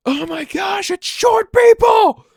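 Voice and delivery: shrieked very high and loud, slowing on the end; obnoxious tourist shrieking at the goblin animatronics; excited shout. Retold or imitated. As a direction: squeal like a giddy kid at the goblins. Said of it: "I started going".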